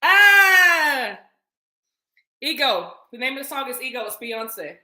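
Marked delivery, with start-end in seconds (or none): 0.0-1.2 long loud falling "ah"; loud holler. Real, not acted. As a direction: let out a high, sliding yell. Loud cry.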